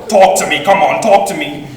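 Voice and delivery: pushing someone to speak up, loud and eager; Uncle Mike catchphrase. Retold or imitated. Nephew mimicking his uncle urging someone, loud coaxing. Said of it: "some of his catchphrases".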